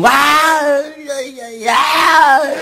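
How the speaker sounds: high hammed-up howl of agony; mock wail of labour pain. Neighbour wails with transferred labour pain.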